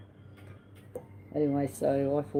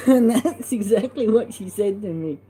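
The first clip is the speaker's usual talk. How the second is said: laughing through the words, loud and high; laughing fondly at her words; 7 semitones higher, 10 dB louder than usual. Real, not acted. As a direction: chuckle it out while remembering her joke. Laughing while retelling sister's joke.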